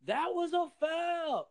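high, shouted put-down; mock harsh yeller. Coach mimicking a yeller berating his players. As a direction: bark it like a coach cutting a kid down. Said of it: "what are you yelling? Are you yelling like".

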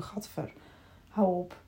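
quiet, grossed-out groan of disgust. Acted. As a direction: recoil as if something smells bad. Mocking hand-in-hand campfire singing. (vol afkeer, bah)

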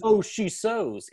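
high, slow silly pun voice; mock Lance. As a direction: ham up the lame pun. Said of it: "It's like".

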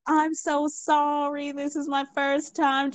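high, drawn-out mock-helpless plea; pleading apology. New server apologises to furious customer, pleading.

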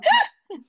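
loud high laugh; big burst of laughter. Real, not acted. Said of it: "ich hab früher immer so gelacht".

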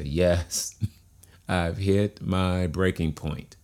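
drawn-out, deliberate admission; slow demonstration. Shown as a demonstration. Coach leading listeners to say yes, slow and deliberate. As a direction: say it slowly, like you mean it. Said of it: "Say it a little bit slower".

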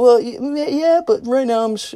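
stammering, high-pitched re-enacted answer; flustered reply. Acting own reply to ladies.

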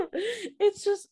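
high burst of laughter breaking the words; laughing at getting choked up; real laughter. Real, not acted. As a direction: laugh through it, overwhelmed. Overcome by emotion, laughing.

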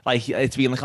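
usual speaking voice

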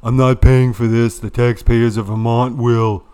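loud, hammed-up old senator rant; Bernie Sanders impression. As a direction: bellow it like a cranky old senator. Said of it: "He immediately responded by saying".

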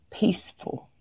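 soft, slow near-whisper; hushed peacefulness. Acted. Coach describing her too-subdued website, hushed and slow. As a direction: breathe the word out gently.

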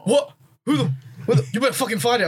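high, outraged mock yell; imitating angry Malik. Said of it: "And he goes".

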